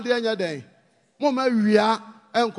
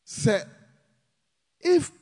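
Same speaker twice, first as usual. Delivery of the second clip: ordinary talk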